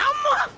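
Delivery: sudden high "amma" cry; yelp of pain. Acted. Victim hit by the bully, high pained yelp. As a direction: cry out sharply as a blow lands.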